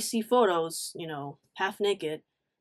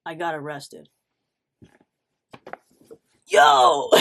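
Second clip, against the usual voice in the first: ordinary talk, nothing marked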